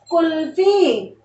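Kulfi said slowly and clearly; slow clear word. Shown as a demonstration. Teacher repeats kulfi slowly for children. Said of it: "क्या शब्द बना बच्चों?".

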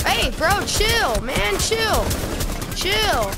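high panicked bro yelling; frat bro. Real, not acted. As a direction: shout chill man, loud and high. Being attacked again in game.

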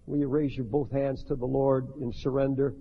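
ordinary delivery

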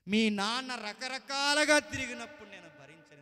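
high, loud thundering dialogue; preaching at full force. Acted. Preacher acting God and Israel to the congregation, thundering. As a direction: hurl the questions and answers at the crowd.

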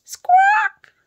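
very high, loud squawk; shrieked bird squawk; hen squawk. A narrator's reading. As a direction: screech like a startled hen.

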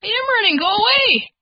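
high frightened little voice; scared child voice. Acted. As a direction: play a scared child running.

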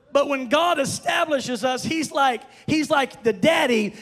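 loud, high, impassioned sermon shouting; full-force preaching. Real, not acted. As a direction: drive it home to the congregation, stretching the key words.

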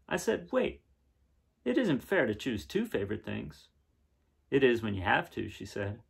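brighter, livelier read-aloud voice; story narration. A narrator's reading. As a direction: read it to the kids with wonder. Reads picture book aloud.

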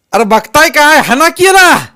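very high, loud yell for help; screaming order. A narrator's reading. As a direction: shriek at his men to attack. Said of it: "असं मानकू पाटील ओरडला". (किंचाळून हुकूम)

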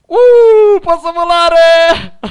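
slow, high, loud cry of delight; excited gamer shout; thrilled discovery. Real, not acted. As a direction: savour the joy of flying. Discovers he can fly in game.

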